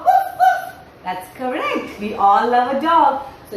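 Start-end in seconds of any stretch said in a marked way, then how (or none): 0.0-0.6 very high-pitched playful woofs; high puppy-like barking; dog bark. Shown as a demonstration. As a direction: yip like an excited little dog. Imitates the dog she names.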